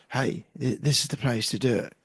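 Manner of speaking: ordinary talk, nothing marked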